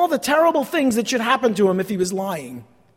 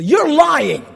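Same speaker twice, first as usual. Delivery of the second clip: voicing accuser; 5 semitones higher, 5 dB louder than usual. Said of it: "he's told specifically".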